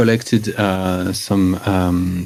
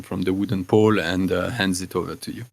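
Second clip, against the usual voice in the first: normal talking voice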